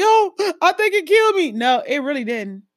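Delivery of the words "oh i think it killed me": high, gasping, half-laughing cry of defeat; mock dramatic collapse. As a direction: ham up a gasp like the workout nearly killed you.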